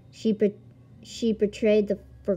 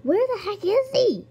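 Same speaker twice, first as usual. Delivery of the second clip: squeaky high voice; 13 semitones higher than usual. Pretend. Searching the game for Scar, squeaky high whine. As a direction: jump way up into a squeaky, cartoonish voice.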